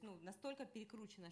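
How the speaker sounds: ordinary delivery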